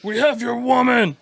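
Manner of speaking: loud high monster bellow; Cookie Monster voice. Retold or imitated. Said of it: "like, Cookie Monster voice".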